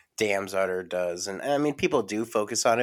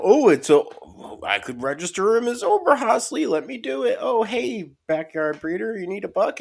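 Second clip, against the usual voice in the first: much higher, excited newbie breeder voice; eager beginner; 11 semitones higher, 7 dB louder than usual. Retold or imitated. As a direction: play the overeager first-time breeder. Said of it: "you know, beginner".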